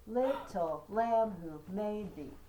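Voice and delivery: Little lamb who made thee, stressed syllables punched and slow; trochaic stress. Shown as a demonstration. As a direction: beat out the trochees, strong then weak. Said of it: "You hear it's da da da".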